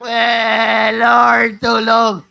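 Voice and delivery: loud, hammed-up wail; mock crying plea. Preacher mimicking people who only pray in need, loud wail. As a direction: wail a fake desperate cry for help. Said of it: "iyak lang iyak".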